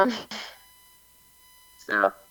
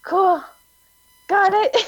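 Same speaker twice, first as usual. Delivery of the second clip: high, nervous, overwhelmed agreement; flustered squeak; 9 semitones higher than usual. Retold or imitated. Spy asks a favor, high flustered nervous reply. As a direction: squeak it out not knowing what to do. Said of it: "I didn't know what to do".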